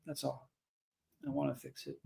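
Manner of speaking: barely getting the words out through tears; choked up. Real, not acted. Telling viewers he wants to fix the world, choking up. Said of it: "I'm a mess".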